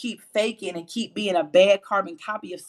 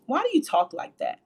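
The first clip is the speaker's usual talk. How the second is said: higher-pitched questioning teacher quote; 6 semitones higher than usual. Retold or imitated. Voice teacher questioning a young opera student, mildly put-on. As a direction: lightly imitate a disapproving voice teacher. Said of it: "I had a voice teacher that said to me".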